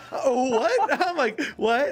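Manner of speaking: high, laughing mock disbelief; high, startled retelling. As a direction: react in alarm, half laughing. Acted startled reply to sleep talk.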